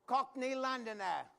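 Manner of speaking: slow, put-on Cockney Londoner; a lightly voiced Cockney Londoner; Cockney accent. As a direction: announce it in a hammed-up Cockney accent. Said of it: "with a British accent".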